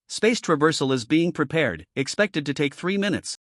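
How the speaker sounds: usual speaking voice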